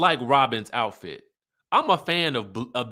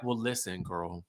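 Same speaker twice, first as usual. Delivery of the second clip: calming friend; 7 semitones lower, 10 dB quieter than usual. Retold or imitated. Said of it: "And Tiffany's like".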